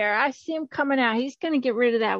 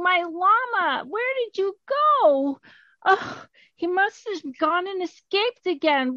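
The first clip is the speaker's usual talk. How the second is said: higher, alarmed farmer searching; mock panic; 6 semitones higher than usual. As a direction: fret aloud as the worried farmer. Farmer finds llama gone.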